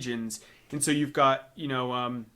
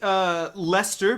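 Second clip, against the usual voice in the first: ordinary talk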